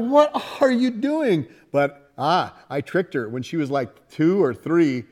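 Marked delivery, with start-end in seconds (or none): 0.0-1.5 baffled dad. Retold or imitated. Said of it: "And I'm like".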